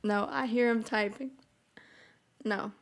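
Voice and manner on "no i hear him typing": usual speaking voice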